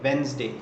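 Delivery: वेडनेसडे as Wenz-day, two syllables; वेडनेसडे said Wenz-day for students to copy; correct pronunciation. Shown as a demonstration. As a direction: repeat वेडनेसडे with the d silent. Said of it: "वेडनेसडे बोलते हैं".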